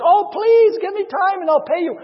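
loud, high begging; hammed-up desperate plea; acted pleading. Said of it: "does the same thing".

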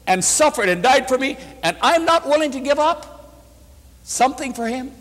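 high, rising preacher's re-enactment of pleading; impassioned tearful plea. Retold or imitated. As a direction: act out the wife's anguished refusal, without sobbing. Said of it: "They cried together. She said".

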